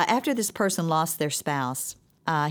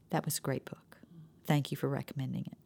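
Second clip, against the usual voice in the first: soft read-out; 4 semitones lower, 9 dB quieter, faster than usual. Retold or imitated. Reading out his text reply.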